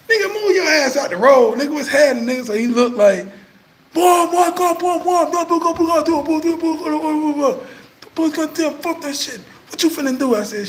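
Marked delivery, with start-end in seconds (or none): 0.1-2.5 high, louder laughing call-out; excited shouting greeting. Retold or imitated. Retold shout at friend. 3.9-10.3 excited hype. Retold or imitated. Friend's excited reply voiced.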